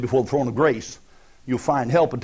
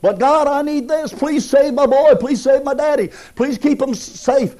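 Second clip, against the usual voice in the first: much higher, louder begging prayer; mock frantic pleading to God; desperate plea; 14 semitones higher, 9 dB louder than usual. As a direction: beg God to save your boy. Pleading prayer for loved ones.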